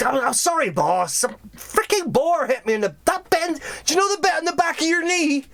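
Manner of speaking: high, pained underling voice; high-pitched hapless guard apologising; apologetic henchman. Acted. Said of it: "'Cause, like".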